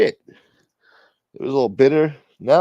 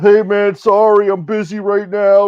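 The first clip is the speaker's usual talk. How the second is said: much higher, louder, put-on brushoff voice; mock friend's reply; 10 semitones higher, 10 dB louder than usual. Reading the friend's text reply aloud, in a high, loud put-on voice. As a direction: squeak out a fake busy excuse.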